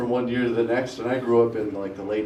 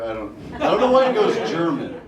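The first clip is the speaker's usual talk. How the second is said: ordinary talk, nothing marked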